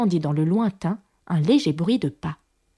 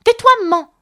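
loud, very high bratty snap; a cross little crab shushing mother; cantankerous; 13 semitones higher, 7 dB louder than usual. A narrator's reading. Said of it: "d'un ton acariâtre". (ton acariâtre et aigu)

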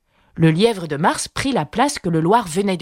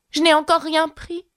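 ton offensé; 10 semitones higher than usual. A narrator's reading. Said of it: "d'un ton offensé".

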